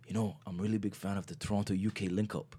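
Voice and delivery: mock London accent for an earnest fan; retold line in a put-on London accent; imitated London accent. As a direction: imitate a London fan's way of talking.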